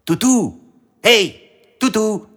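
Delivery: high-pitched hailing call. A narrator's reading. The fox hails Toutou from a bush, calling out. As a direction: call the dog from a distance.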